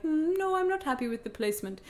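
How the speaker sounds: lightly voiced German doctor, unbothered; a hint of a calm, detached German doctor; German medical voice. Patient mimicking the doctor judging the IUD placement, light German doctor voice. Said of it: "I love your German medical voice".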